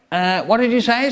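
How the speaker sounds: high, cheerful inspector; cheery. A narrator's reading. Inspector questions the cafe staff, cheery and high. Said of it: "said the inspector cheerily".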